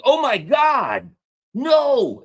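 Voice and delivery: loud, high, drawn-out exclamation; mock shock. Putin's listener reacts to ships being sent, mock shock. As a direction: stretch each word in hammed-up disbelief. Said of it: "He's like".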